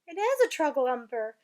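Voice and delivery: exasperated retort; loud exasperated giant. Acted. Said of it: "cried the exasperated B F G".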